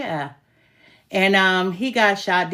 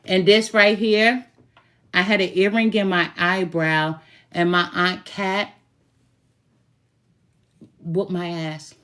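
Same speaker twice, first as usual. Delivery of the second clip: normal talking voice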